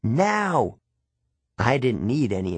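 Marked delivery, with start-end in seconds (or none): 0.0-0.8 urgent shout. A narrator's reading. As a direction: shout him out the door. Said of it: "Annabeth yelled at me".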